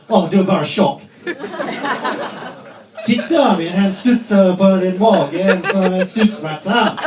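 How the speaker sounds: imitating son. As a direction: tease it like a gleeful kid. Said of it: "it goes like this".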